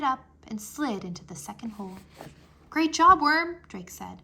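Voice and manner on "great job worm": high, loud cheer. Acted. Drake cheering Worm for lifting the stone. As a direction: celebrate the win out loud.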